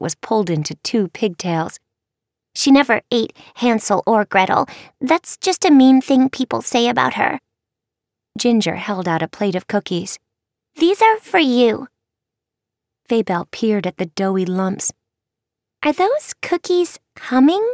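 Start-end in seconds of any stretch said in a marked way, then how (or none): 2.5-7.4 put-on sweet earnest young girl; higher, earnest child; little girl voice. Ginger defends her mother. 10.7-11.9 put-on high, sweet little girl. Ginger offers cookies. 15.8-17.7 put-on high, puzzled young girl; wide-eyed wondering child; curious girl. Faybelle curious at cookies.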